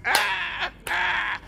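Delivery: high, loud strained cry of "ah"; bra crying out. Pretend. Straining bra cries out under the load, mock high-pitched wail. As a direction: play an overloaded bra yelling for help. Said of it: "I can hear that bra crying out".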